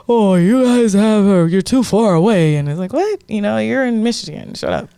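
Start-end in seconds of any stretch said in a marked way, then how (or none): 0.1-2.6 loud, higher-pitched mock complainer; Joe Blow voice. Mocking a random online commenter griping about the cafe, Joe Blow voice. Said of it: "I like your Joe Blow voice". 2.9-4.9 mock retort. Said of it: "some random Joe Blow that's just like".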